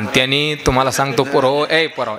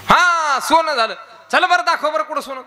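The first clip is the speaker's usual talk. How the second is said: mocking retort; 13 semitones higher than usual. Angry mourner demands gold.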